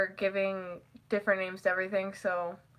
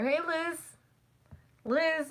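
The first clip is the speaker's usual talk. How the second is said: higher, softer sing-out greeting; calling out a nickname; 7 semitones higher, 4 dB quieter, slower than usual. Retold or imitated. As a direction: lightly call to her, pitched up.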